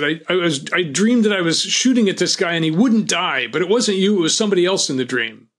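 high and loud, rushing to the end; the rattled gunman explaining his dream; agitated babble. Retold or imitated. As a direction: spill it out in a frightened rush.

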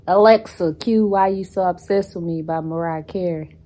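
loud call across the room; calling out a command. Real, not acted. Vlogger ordering Alexa to play a song, loudly. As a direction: project it firmly to a voice assistant.

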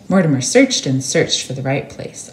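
ordinary delivery, nothing marked

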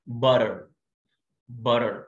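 butter with a flapped t, barer; American butter. Shown as a demonstration. Teacher modeling butter. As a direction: say butter with the t as a quick d. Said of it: "وباتر صح".

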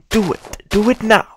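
building from firm to a high yell; demanding shout. Pretend. Demanding grandchildren, rising to a yell.

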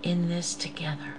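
hushed whisper of togetherness; softly whispered appeal. Real, not acted. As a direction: drop to a whisper to draw them in. Intimate appeal to congregation.

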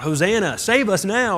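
loud, high cry of "Hosanna, save us now"; crowd shouting. Retold or imitated. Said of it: "that crowd that was shouting out".

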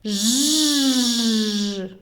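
long droning "zhhh"; sustained voiced buzzing; beetle buzz. Shown as a demonstration. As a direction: imitate a big beetle circling overhead. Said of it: "Жук великий пролітав і над нами закружляв".

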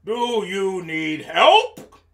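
loud, slow question swooping up; big lion voice. Acted. Lion offering the others help, big and slow. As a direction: draw it out as a storybook lion.

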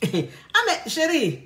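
slow, amused Ah mais chérie through a laugh; laughing husband. Acted. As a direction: chuckle it out, puzzled by her sulk. Husband puzzled by sulking wife.

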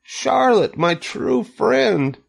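high, drawn-out wail; grief-stricken high moaning; anguished moan. A narrator's reading. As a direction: lament slowly in a high voice. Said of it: "he moaned".

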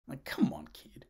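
breathy muttered aside; whispered aside. Real, not acted. Reviewer whispers a jab at the kid. Aside about Jane's hair.

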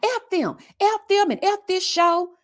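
hammed-up furious outburst; mock angry. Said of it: "she's talking about".

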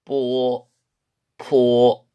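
뽀 unaspirated then 포 with a strong puff; 뽀 and 포 as Chinese syllables. Shown as a demonstration. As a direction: say 뽀 tight, then 포 with breath. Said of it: "공부를 했습니다".

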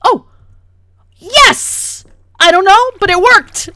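loud, high burst of elation; triumphant cheer. Real, not acted. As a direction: cheer in relief as it finally works. Triumph after finally succeeding.

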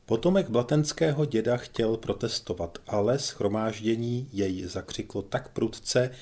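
plain reading voice

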